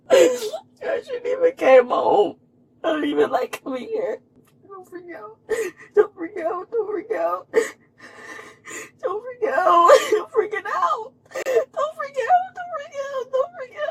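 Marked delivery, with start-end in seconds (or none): 0.1-2.4 slow, loud sobbing between half laughs. Real, not acted. Regretting coming home while under arrest, sobbing. Panicking alone after a DUI arrest. 4.7-13.9 tearful pleading with herself, voice cracking higher; crying self-talk. Real, not acted. Talking herself down in the police car, crying.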